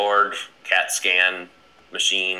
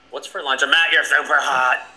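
higher, mock-excited blurt; sudden gushing outburst; 6 semitones higher, 4 dB louder than usual. Host acting out his own passing thought, gushing. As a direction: build into a louder, higher mock gush.

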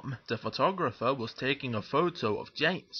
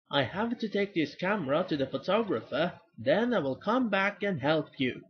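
higher, lilting call to others; 5 semitones higher than usual. Acted. Thomas calling to Percy and James in passing, raised voice. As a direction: call it over, pitched up. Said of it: "he called".

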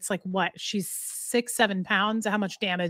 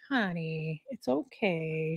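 much softer, lower, slow hush; soothing the dog; 5 semitones lower, 8 dB quieter, slower than usual. Real, not acted. Breaking off to calm the sad dog, soft and low. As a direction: hush her gently.

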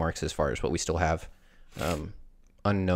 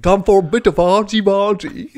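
much higher, louder cartoon Brit; mock British accent; 14 semitones higher, 10 dB louder than usual. Co-host topping the bit in a high cartoon British accent. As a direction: throw in some cheeky British slang voice.

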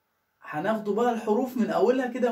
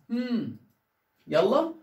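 ordinary delivery

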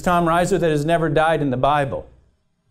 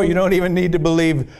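ordinary delivery

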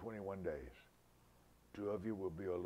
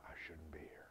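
whispered plea; 3 semitones lower, 10 dB quieter than usual. Retold or imitated. Joseph's quiet plea.

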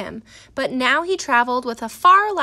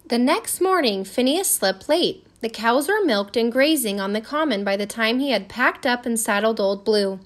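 plain reading voice

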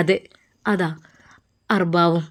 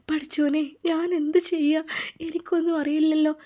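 higher, softer, trembling plea to God; fearful prayer; 5 semitones higher, 5 dB quieter than usual. Acted. Farsana pleading with God on the road, fearful and hushed. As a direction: pray in rising fear, voice lifted and hushed. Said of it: "അവളുടെ മനസ്സിൽ പേടി കൂടിക്കൂടി വന്നു".

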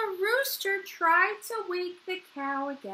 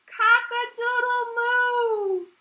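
raised, drawn-out moo-crow; crowing cow; 6 semitones higher, 5 dB louder, slower than usual. A narrator's reading. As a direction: crow slowly with a long moo. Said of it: "The cow went".

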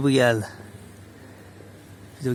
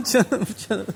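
cooing to a kitten; 7 semitones higher than usual. Real, not acted. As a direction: coo it up high to the kitten.

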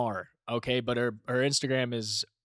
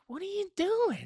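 exasperated trainer; 4 dB quieter than usual. Retold or imitated. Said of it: "I'm, like, making noises".

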